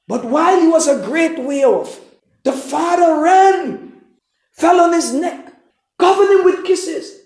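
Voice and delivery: fiery preacher exclaiming the father's love; excited preaching. Real, not acted. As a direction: lift it up, the father running with joy. Preacher builds to climax.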